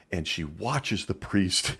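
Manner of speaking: ordinary talk, nothing marked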